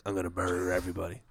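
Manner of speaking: low, soft, fast demon mutter; low hurried demon voice; demonic voice. Retold or imitated. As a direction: sound possessed and creepy. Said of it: "weird, like, type of".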